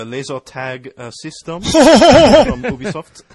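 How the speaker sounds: put-on slow, halting French-accent parody; mock French accent. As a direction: play the French developer haltingly, for laughs. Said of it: "He was saying with a French accent".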